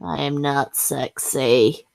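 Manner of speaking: usual speaking voice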